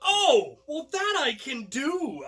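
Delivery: high, loud burst of glee; mock thrilled, like the answer solves everything; delighted relief. Told the sketch is improv, he whoops with loud, high delight.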